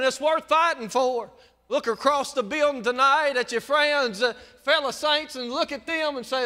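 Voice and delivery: loud, high altar-call preaching; preacher pushing the congregation; full-force preaching. Real, not acted.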